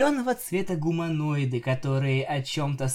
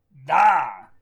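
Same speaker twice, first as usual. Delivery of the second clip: loud, growled answer; beastly growl, raised in volume; 7 dB louder than usual. A narrator's reading. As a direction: growl it out forcefully. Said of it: "сказал рычащий голос".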